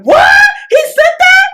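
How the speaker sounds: very high, loud disbelieving shriek; mock shock. All the girls hearing about the text, mock shock.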